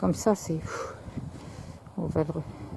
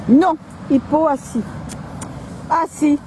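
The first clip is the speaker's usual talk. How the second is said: firm dog command; 7 semitones higher than usual. Real, not acted.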